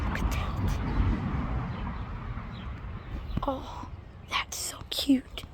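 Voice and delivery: hushed, breathy whisper. Real, not acted. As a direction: whisper so the birds don't hear. Whispering so the birds stay.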